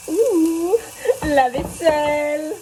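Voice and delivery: drawn-out mock groan; sing-song dread of dishes.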